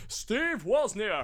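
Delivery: high, drawn-out mock shout held back in volume; half-volume long-distance holler; pretend shout. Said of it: "I shout it out".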